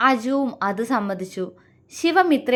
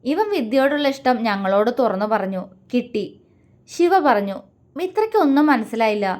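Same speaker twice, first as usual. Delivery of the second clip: ordinary delivery, nothing marked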